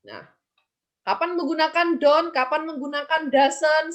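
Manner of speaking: ordinary delivery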